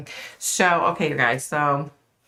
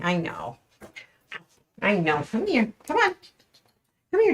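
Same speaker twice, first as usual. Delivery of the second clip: high, sing-song pet talk; coaxing the dog; 7 semitones higher than usual. Real, not acted. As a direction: coax a frightened puppy over to you. Coaxing her scared dog.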